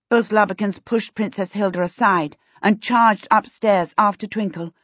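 plain reading voice

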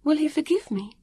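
very high, plaintive question; small, high, remorseful voice; close to tears. A narrator's reading. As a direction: ask it as if about to cry. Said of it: "felt more like crying than laughing".

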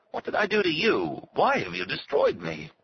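a ruined man begging to know why; anguished plea. A narrator's reading. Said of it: "Otto asked".

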